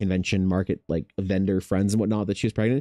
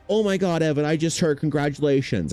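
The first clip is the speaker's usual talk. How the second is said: higher, mock-excited gushing; gushing congratulations; 7 semitones higher than usual. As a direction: imitate a friend gushing at the news. Friend gushing over pregnancy news.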